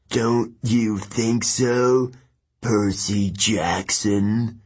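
cold, ancient voice, very slow; Kronos. Acted. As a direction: taunt your enemy with chilling, drawn-out words. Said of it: "cold sound like metal scraping".

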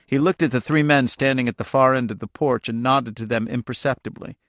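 usual speaking voice

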